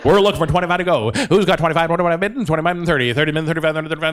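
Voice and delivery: rapid-fire rolling auction chant; auctioneer. Retold or imitated. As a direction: chant bids on one note without breathing. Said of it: "said he wanted to be an auctioneer".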